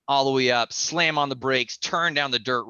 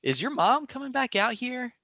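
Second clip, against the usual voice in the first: much higher, curious question; wondering; 12 semitones higher than usual. Retold or imitated. Him asking the kid about mom, higher. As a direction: lightly wonder aloud. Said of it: "I'm just thinking".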